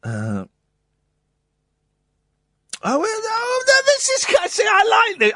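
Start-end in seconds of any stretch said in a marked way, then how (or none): 2.8-5.3 excited. Real, not acted. Excited over a book find.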